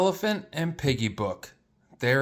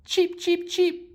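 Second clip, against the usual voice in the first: tiny high chirping peeps; baby chicks; 13 semitones higher, slower than usual. Acted. Reader voicing the baby chicks, high tiny cheeps.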